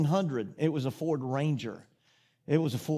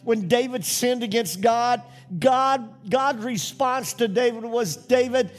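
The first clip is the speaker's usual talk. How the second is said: higher and louder, rising sermon fervor; impassioned preacher proclaiming forgiveness; preaching at full force; 6 semitones higher, 4 dB louder than usual. Acted. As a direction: drive the message home to the congregation.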